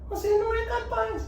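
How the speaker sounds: mock the inner critic way up high; silly mocking reply. Shown as a demonstration. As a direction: echo it back in a silly high whine. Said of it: "você fala assim".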